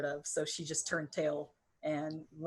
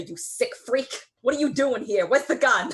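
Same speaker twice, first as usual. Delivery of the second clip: loud, high demand; angry confrontation; 7 semitones higher, 9 dB louder than usual. Acted. Player's character pins him against a wall, angry shout. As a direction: shout it like an interrogation.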